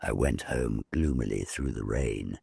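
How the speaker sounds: ordinary delivery, nothing marked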